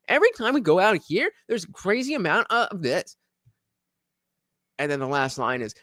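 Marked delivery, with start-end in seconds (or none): 0.1-3.2 high, lilting TikTok narrator cadence. Acted. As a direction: imitate a TikTok voice-over, phrases swinging upward. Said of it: "that's the TikTok voice I was trying to get".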